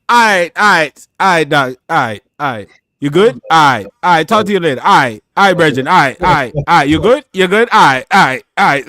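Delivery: loud, clipped West Indian sign-offs, over and over; West Indian phone goodbye. Acted. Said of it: "trying to get off a phone with a current West Indian".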